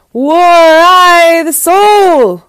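high, loud, long-drawn howl; wailing. A narrator's reading. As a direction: howl each word out slowly like a wailing wolf. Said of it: "began to cry and wail".

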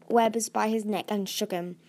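ordinary delivery, nothing marked

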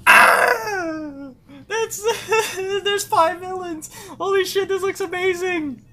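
high squealing excitement with a gasp; overjoyed squealing. Real, not acted. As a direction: fan losing it over the reveal. Sees five villains in trailer.

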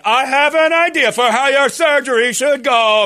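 high, loud, slow doctor voice; hammed-up overeager surgeon; mock doctor. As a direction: drag it out like a clueless doctor. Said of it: "If my doctor came in and said".

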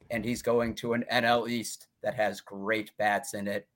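ordinary talk, nothing marked

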